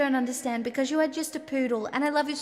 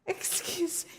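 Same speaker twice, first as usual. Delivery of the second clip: ordinary talk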